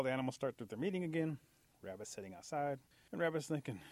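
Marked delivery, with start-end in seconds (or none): none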